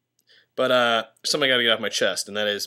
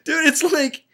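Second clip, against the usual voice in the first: higher, whimpering voice; half-crying whine; 14 semitones higher, slower than usual. Real, not acted. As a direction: whine it close to tears. Panicked, near tears.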